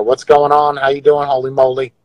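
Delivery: ordinary talk, nothing marked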